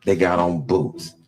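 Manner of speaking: low, unbothered reply; calm old inmate. Retold or imitated. Old inmate answering the warning, calm and low. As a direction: take the news calmly, pitched well down. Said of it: "You pretty calm about a riot".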